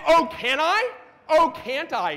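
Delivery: loud, high indignant comeback; defiant challenge. Acted. Programmer answering an error message back, defiant.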